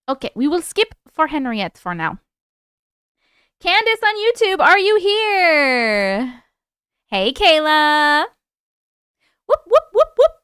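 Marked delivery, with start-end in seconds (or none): 3.6-8.3 calling out. Real, not acted. Calling out for a viewer. 9.5-10.3 high, loud, quick whoops; whooping. Real, not acted. Playful hype noise.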